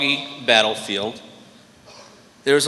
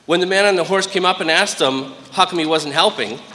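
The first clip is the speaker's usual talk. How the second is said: normal talking voice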